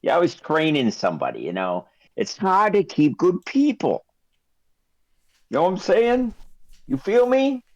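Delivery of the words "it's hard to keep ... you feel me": chummy shopkeeper. Acted. Shopkeeper chats up customers.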